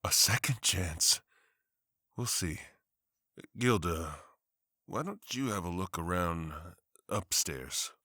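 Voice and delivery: low, soft, tender reply; gentle husband. Acted. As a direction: soothe your wife quietly. Husband gently answers wife's hope.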